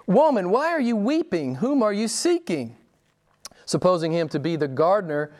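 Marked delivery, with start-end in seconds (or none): 0.1-2.8 higher-pitched quoted question to a weeping woman; Jesus questioning. Retold or imitated. Jesus asks weeping Mary whom she seeks, questioning. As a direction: put the question to her, voice lifted.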